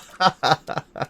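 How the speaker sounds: high burst of laughter; real laugh. Real, not acted. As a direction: laugh at your own silly bit. Laughs after own hammy introduction.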